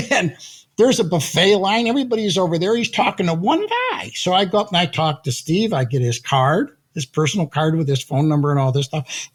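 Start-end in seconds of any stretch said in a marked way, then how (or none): none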